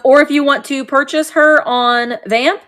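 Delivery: ordinary talk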